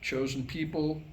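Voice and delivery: ordinary talk, nothing marked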